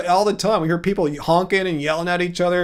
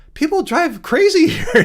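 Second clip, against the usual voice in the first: laughing mid-line, voice up high; amused laugh breaking into the words; 11 semitones higher, 4 dB louder than usual. Real, not acted. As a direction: chuckle through it in disbelief. Laughing about Boston drivers.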